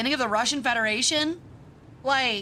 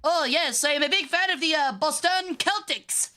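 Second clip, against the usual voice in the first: put-on higher-pitched joking Boston accent; mock Boston accent; 5 semitones higher than usual. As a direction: ham up a Boston sports fan accent. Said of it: "What kind of accent do you guys think they have in Boston?".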